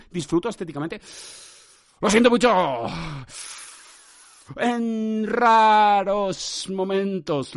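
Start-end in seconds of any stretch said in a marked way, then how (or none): 2.0-2.8 sudden loud, higher outburst; shouted apology. Pretend. Podcaster breaks his own silence to apologise, shouting it. 4.6-7.5 very slow, stretched higher vowels; elongated words. Pretend. Podcaster admits he rarely enjoys doing this, hugely elongated delivery. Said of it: "estas alargadas que he hecho".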